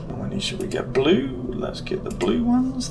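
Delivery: normal talking voice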